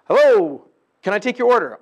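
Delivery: loud, chirpy order-taker voice; drive-through clerk. Retold or imitated. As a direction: play a cheery fast-food clerk.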